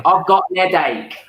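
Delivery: high-pitched Cockney with dropped H; demonstrating a Cockney twang; Cockney accent. Shown as a demonstration. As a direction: put on Cockney, "headache" losing its H. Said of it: "In Cockney, you'd say".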